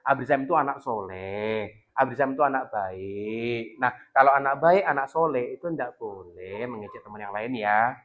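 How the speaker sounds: put-on soft slow coaxing to a child; patient, tender parent voice; gentle advice. Shown as a demonstration. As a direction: talk the child round softly, slowly. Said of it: "jangan langsung bilang".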